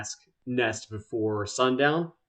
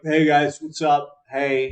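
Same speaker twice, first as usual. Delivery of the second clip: louder, higher hail to pals; chummy greeting; 4 semitones higher, 8 dB louder than usual. Acted. Molnar hailing every plant like he knows them, chummy. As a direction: call out a breezy hello. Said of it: "greeting every plant around here".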